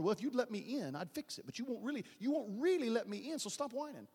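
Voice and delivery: ordinary delivery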